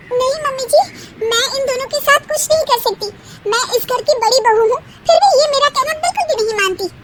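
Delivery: high, fast complaining to mother-in-law; the proud eldest bahu protesting, near tears; whiny eldest daughter-in-law. Acted.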